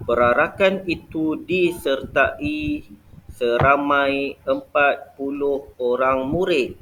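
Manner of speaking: slow, clear dictation of the example; slow example sentence. Shown as a demonstration. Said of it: "Contoh lain". (ayat contoh dibaca perlahan)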